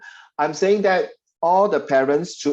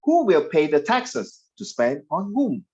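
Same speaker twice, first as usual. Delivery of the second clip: "Who will pay the taxes? To spend on whom?" read slowly, stretching "whom"; slow questioning read; slower than usual. Shown as a demonstration. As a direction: pause and let "whom" fall. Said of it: "you must like that".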